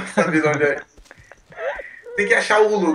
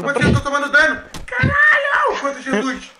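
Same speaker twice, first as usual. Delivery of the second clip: fast, high frantic yelling; panicking gamer; 7 semitones higher than usual. Real, not acted. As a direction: yell in a panic as hits land. Taking damage, frantic.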